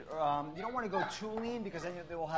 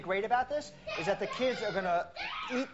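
talking over noise; 4 semitones higher, 5 dB louder than usual. Real, not acted. Talking over screaming kids.

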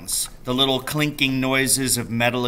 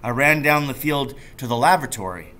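ordinary delivery

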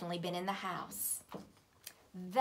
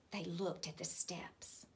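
whispered narration; 3 semitones lower, 7 dB quieter than usual. A narrator's reading. Whispered storytelling.

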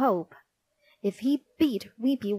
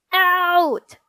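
high, loud, drawn-out dismissal; furious schoolteacher's yell; Miss Battle Axe's shout; 9 semitones higher, 8 dB louder than usual. A narrator's reading. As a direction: bellow it at a pupil. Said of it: "shouted Miss Battle Axe".

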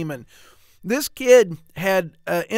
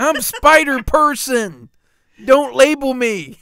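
higher, louder indignant hero; mock woke hero; 5 semitones higher, 6 dB louder than usual. Friend mocking the new Spider-Man, high indignant voice. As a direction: play a touchy parody hero.

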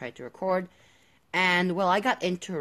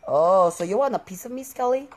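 cocky taunt, louder; 5 semitones higher, 8 dB louder than usual. Real, not acted. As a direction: goad the enemy. Taunting a skeleton mob.

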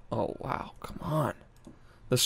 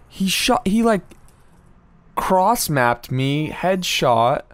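incredulous; 6 semitones higher, 4 dB louder, slower than usual. Real, not acted. Retelling how he was shot.

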